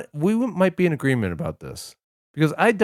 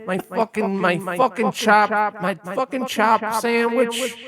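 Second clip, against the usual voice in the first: high Brooklyn guy voice; lightly voiced New York wiseguy; Brooklyn accent; 7 semitones higher than usual. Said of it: "Brooklyn guy on".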